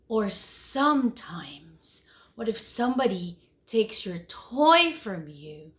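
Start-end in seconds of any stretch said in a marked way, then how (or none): none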